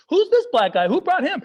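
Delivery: loud, high, indignant demand; mock outrage. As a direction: call it out like a scandalized auntie. Outraged repeat of aunties' question.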